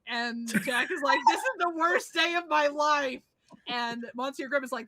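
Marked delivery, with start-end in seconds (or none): 1.3-3.2 dramatic despair. Retold or imitated. Jack despairs after eating.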